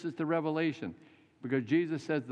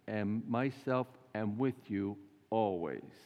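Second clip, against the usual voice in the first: drawn out, unhurried always; slow, measured promise of Jesus; slow solemn promise; 3 semitones lower, slower than usual. Retold or imitated. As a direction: stretch the words like a vow.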